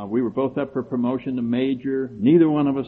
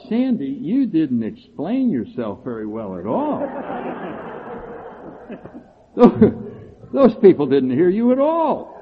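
higher, louder, laughing exasperation; laughing disbelief; 7 semitones higher, 4 dB louder than usual. Retold or imitated. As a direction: laugh at the absurd advice. Retold self-talk after meeting.

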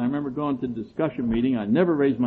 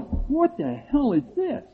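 higher, slow, stretched-out despair; exasperated cry; 14 semitones higher, slower than usual. Retold or imitated. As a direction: drag it out in disbelief. Retold despair.

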